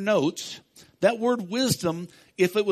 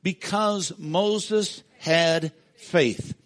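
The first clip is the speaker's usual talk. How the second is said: slow group reading; much slower than usual. Real, not acted. Pastor leading the congregation in reading, slow and measured. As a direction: read it slowly together with the church. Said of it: "Would you read that with me?".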